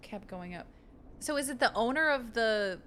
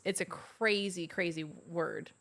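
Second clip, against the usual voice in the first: ordinary talk, nothing marked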